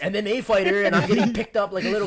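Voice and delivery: high, loud embarrassed panic; panicked inner voice. Retold or imitated. Guard being lifted in a hug, loud high panic. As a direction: squeal it out while hoisted like a kid.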